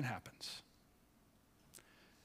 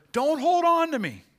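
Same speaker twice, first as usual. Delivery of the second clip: "Don't hold on to me" much higher and louder, pleading; urgent high cry; 14 semitones higher, 10 dB louder than usual. Acted. Jesus telling Mary not to cling to him, urgent high cry. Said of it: "He tells her".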